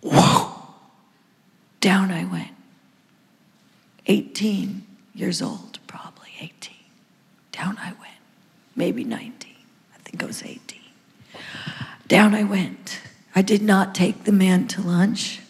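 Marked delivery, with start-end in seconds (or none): 1.8-10.8 soft awed whisper; hushed awe. Retold or imitated. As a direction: whisper it as if still overwhelmed. Awed memory of falling in Spirit.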